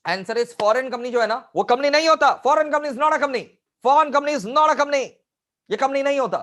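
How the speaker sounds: loud, high-pitched verdict; emphatic declaration. Real, not acted. Teacher declares the answer firmly.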